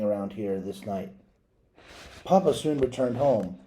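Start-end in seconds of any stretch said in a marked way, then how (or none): none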